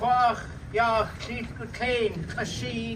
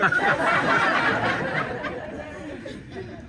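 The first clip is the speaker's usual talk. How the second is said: breathy laugh; 12 semitones higher than usual. Real, not acted.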